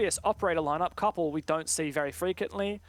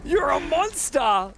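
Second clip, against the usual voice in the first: big hyped yell at the play; high, loud caster hype call; excited shout; 10 semitones higher, 5 dB louder, slower than usual. Real, not acted. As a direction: shout it like the crowd just erupted. Caster hypes a clutch play.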